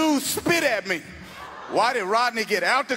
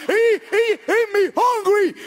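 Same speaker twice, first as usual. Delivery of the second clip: high, loud, slow slurred shout; brother impression; 11 semitones higher, 6 dB louder, slower than usual. Brother demanding food at the door, high loud shout. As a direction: yell it high like the hungry brother.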